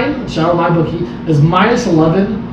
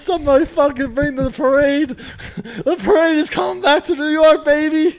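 high, laughing fake hype about a parade; mock celebration; 8 semitones higher than usual. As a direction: ham up a fan's overblown victory parade. Mocking Jets fan's preseason hype.

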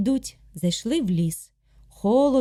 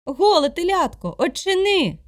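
high, louder pleading call; 7 semitones higher, 5 dB louder than usual. Acted. Awl begging the calf to open, loud high call.

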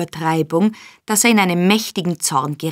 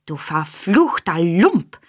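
mild shout; slower than usual. A narrator's reading. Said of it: "schrie Charlotte".

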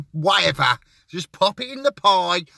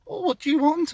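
baker's voice; 12 semitones higher, faster than usual. Retold or imitated.

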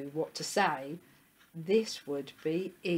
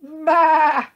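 high, loud, wavering baa; long storybook sheep call; sheep bleating; 11 semitones higher, 16 dB louder than usual. A narrator's reading. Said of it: "The sheep looked at him".

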